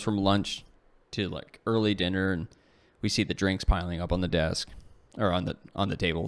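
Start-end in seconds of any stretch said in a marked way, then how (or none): none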